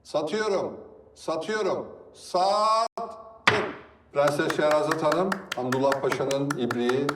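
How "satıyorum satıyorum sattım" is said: fast, rhythmic auction calling; auctioneer. Acted. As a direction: call out bids like an auctioneer.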